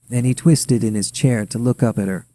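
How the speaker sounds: ordinary delivery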